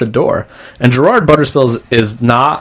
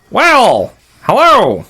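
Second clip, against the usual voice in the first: very high-pitched, weird official voice; strange, high gatekeeper voice; weird attendant; 13 semitones higher, 4 dB louder than usual. Acted. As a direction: pipe up high like an odd dockside attendant. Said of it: "they sound weird".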